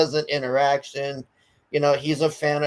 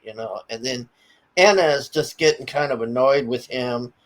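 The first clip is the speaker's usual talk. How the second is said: ordinary talk, nothing marked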